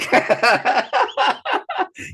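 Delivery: real amused laugh, high and loud; loud burst of laughter. Real, not acted. Preacher laughing at the blind man's obnoxious yelling, loud laugh. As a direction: break into a big laugh.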